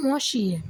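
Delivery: "মসিয়ে" with a held m, mo-shi-ye; French word demonstration. Shown as a demonstration. Teacher modelling the French title for learners, "মসিয়ে" as mo-shi-ye. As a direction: model "মসিয়ে", drawing out the opening. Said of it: "ঠিক ওভাবেই ওরা উচ্চারণগুলো করে".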